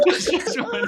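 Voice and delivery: high squeaky laughing words. Real, not acted. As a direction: talk while cracking up.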